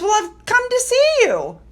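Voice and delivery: voicing the footballer. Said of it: "And the guy goes".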